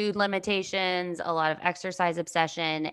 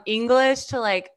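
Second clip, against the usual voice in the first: ordinary delivery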